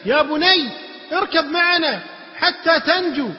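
high, loud, slow pleading call; calling out to son. Acted. Father calling his son to safety, calling out. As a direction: call out to save your child. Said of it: "ينادي ابنه".

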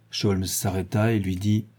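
ordinary delivery, nothing marked

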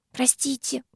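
soft, high apologetic squeak; tiny squeak. A narrator's reading. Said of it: "пискнула Диана".

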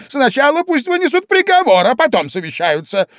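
very high, loud, imperious; queen shouting at the court. A narrator's reading. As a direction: bellow the order.

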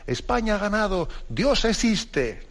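high, drawn-out commentator's victory cry; joyful mock cry. TV commentator announces Spain's penalty win, imitated joyful cry. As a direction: imitate an elated football commentator, pitched high. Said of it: "da un grito de alegría".

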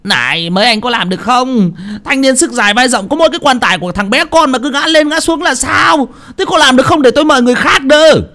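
high-pitched angry scolding; furious high-pitched rant; angry yell. Acted. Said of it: "ông Bình tức giận quát lên".

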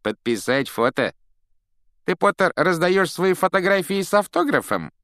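high, loud, jeering schoolboy; mock him loudly in disbelief; loud mockery. A narrator's reading. Draco taunts Potter in front of the whole yard, loud and mocking. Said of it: "Громкий насмешливый голос Драко Малфоя".

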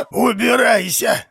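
high, slow hoarse yell; hoarse shout. Acted. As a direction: rasp out a furious slow yell. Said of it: "Хрипло отозвались изнутри".

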